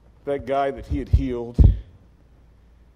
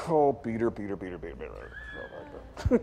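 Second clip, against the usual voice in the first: low, trailing-off weary repetition; exasperated sigh; 8 semitones lower, 6 dB quieter than usual. Retold or imitated. Jesus seeing Peter's sword swing, exasperated trailing off. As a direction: let the name fade into a mumble. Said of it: "I can picture Jesus going".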